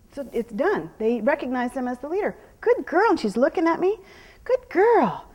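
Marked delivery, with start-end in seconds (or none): 2.6-5.2 higher, louder happy praise; bright praise. Real, not acted. Trainer rewarding the horse as she looks, higher happy praise. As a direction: lift the voice to reward her.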